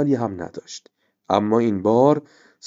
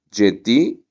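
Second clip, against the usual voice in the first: surprised; 5 dB louder, much slower than usual. A narrator's reading. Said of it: "ریحانه با تعجب گفت".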